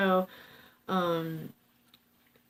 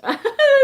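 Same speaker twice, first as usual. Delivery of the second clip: burst of laughter; 15 dB louder than usual. Real, not acted. Laughs at own pitch.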